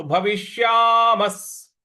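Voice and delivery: भविष्यामस् ending in a clear स sound; slow भविष्यामस् with a final s; visarga said as s. Shown as a demonstration. Teacher slowly models भविष्यामस् with the visarga as s. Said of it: "उसका उच्चारण हमें स ले आना है".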